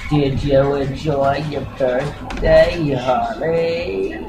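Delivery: put-on slow, husky older lady's question; smoker's voice. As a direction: drawl it like a longtime smoker. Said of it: "she's got the smoker's voice".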